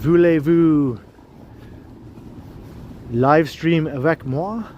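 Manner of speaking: hammy French introduction, slowing at the end; jokey French accent greeting the stream. Pretend. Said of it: "French accent".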